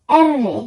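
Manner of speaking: আর রে with a trilled r; আর রে with a rolled Italian r. Shown as a demonstration. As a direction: vibrate the r in আর রে. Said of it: "vibration হবে".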